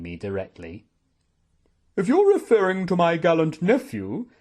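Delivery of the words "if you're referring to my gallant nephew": loud, high-pitched defensive voice; indignant aunt. Acted. Aunt defends her nephew against suspicion, indignant. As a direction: leap loudly to his defence.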